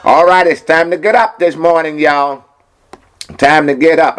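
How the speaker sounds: booming gospel DJ calling out to listeners; radio preacher hype. Acted. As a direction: wake the whole house up with the Word. Morning radio host hyping listeners.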